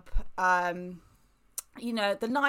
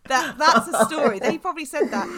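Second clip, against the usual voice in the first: laughing; 5 semitones higher, 6 dB louder than usual. Real, not acted. Host laughing at imagined retelling.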